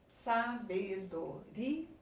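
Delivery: slow, syllable-by-syllable sabedoria, rising on RI; stress on RI. Shown as a demonstration. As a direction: spell out sabedoria by syllables, lifting RI. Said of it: "uma tônica".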